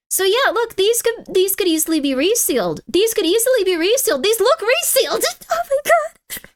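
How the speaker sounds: high-pitched frantic rant; frantic exasperation. Real, not acted. Streamer insisting to chat that packs look resealed, frantic. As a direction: build up to a very high, exasperated cry.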